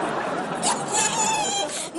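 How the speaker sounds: shrieking high laugh; high cackle. Acted. Mocking Hillary about bringing Naomi, shrieking cackle. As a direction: cackle at your own joke.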